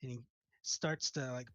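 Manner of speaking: usual speaking voice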